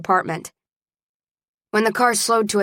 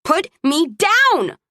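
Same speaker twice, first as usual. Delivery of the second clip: high, slow, drawn-out cry; screamed demand; 9 semitones higher, 3 dB louder, much slower than usual. A narrator's reading. Carried woman demands release, screaming. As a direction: stretch each word in a high outcry. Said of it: "I screamed".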